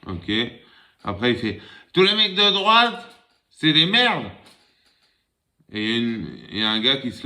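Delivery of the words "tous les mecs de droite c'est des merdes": put-on drunk rant. Drunk man ranting in bar.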